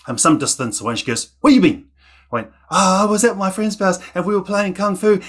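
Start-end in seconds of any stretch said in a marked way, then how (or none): 1.4-1.9 high, loud demand from an angry mother; acted scolding shout, pitched way up; angry mum. Angry mum confronts child in story. 2.7-5.2 put-on high-pitched kid explaining himself; acted child in trouble, voice pitched up; nervous child's excuse. Scared child answers mum in story.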